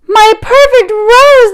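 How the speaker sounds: high, slow wail over the rose; band leader crying out. Acted. Band leader wails over his ruined rose. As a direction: cry it out like a heartbroken band leader. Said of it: "the band leader cried".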